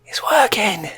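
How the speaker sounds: slow, triumphant whisper; gleeful whisper. Acted. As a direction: hiss it to yourself, savouring it. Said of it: "He's going".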